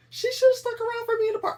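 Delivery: high, loud, fast mock whine; mocking whiny voice. Mocking people who expect rescue, high whiny voice. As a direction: whine it like a needy victim. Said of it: "I'm not trying to say".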